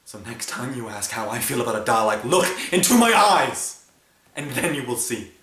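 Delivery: loud, building to a high shout; rising rage, then easing slightly; furious challenge. Acted. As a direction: confront them, erupting on the command. Angry challenge to the listener.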